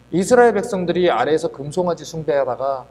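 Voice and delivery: ordinary talk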